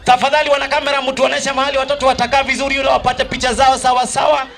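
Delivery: loud, high, rallying MC call; hype MC. Real, not acted. MC rallying the crowd for the second runners-up, loud and high. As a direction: pump the crowd up. MC hyping winners over music.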